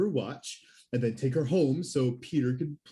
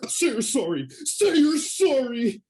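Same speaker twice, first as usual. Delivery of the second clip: slow whiny wail; 10 semitones higher, slower than usual. Acted. Goon cries over juice box.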